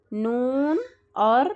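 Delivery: نون drawn out very slowly, humming through the nose; ghunna demo. Shown as a demonstration. Teacher stretching out نون to show ghunna. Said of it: "نون اور میم پر دیکھیے".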